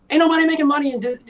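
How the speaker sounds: high, loud and fast; heated rant. Real, not acted. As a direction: rush it out in exasperation.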